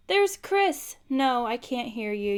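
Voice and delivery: normal talking voice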